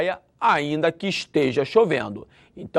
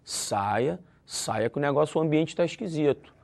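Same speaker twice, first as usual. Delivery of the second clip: low, much softer friendly warning; advising hush; 6 semitones lower, 7 dB quieter than usual. Shown as a demonstration. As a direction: murmur "Ó, saia" as quiet advice. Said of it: "vou dar uma ideia".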